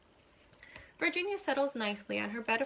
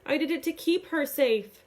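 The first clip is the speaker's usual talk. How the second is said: pleading; 4 semitones higher, 6 dB louder than usual. A narrator's reading. Desperate justification.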